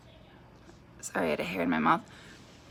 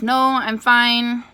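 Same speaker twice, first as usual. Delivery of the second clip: dismissive reassurance; 6 semitones higher, 9 dB louder, much slower than usual. Retold or imitated. Woman brushes off mom's concern.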